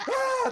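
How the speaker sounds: very high, loud mock scream; hammed-up shriek of terror; imitated scream. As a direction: scream like fleeing kids. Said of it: "They're screaming and they're hollering".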